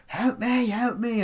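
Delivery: high pleading cry for help; calling for help. Retold or imitated. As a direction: beg someone upstairs to hear you. Trapped girl calling for help.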